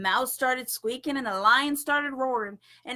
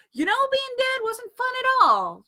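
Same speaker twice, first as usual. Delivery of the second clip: much higher, louder folksy complaint; Paul's voice; 12 semitones higher, 8 dB louder than usual. A narrator's reading. Paul back from the dead, complaining loud and high. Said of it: "said Paul".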